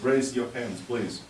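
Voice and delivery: ordinary talk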